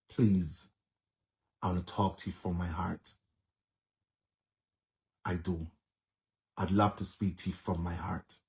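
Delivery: soft, heartfelt and hushed; sincere plea. Real, not acted. Pleading softly with Marcia to talk from the heart. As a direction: drop the voice low and mean every word.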